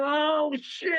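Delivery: high, drawn-out yelp of alarm; alarmed cry. Retold or imitated. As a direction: retell the shock of catching fire. Retold shock as flames catch arm.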